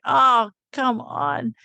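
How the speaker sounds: drawn-out, slow, high groan of protest; exasperated. Retold or imitated. Pleading with her vision not to make her stay, exasperated. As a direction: stretch it out like a whiny plea. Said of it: "And I was like".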